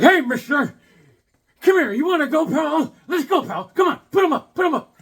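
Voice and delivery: high, loud, hammed-up taunting; mock fight challenge. Dog walker re-enacting challenge to old neighbor, hammed-up shouting. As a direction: shout like you're picking a playful fight. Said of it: "I was like this".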